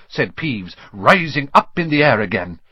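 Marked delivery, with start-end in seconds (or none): none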